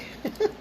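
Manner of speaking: quick, soft chuckle; brief real laugh under the breath; small laugh. Real, not acted. Host amused by her little buddy cat, small laugh.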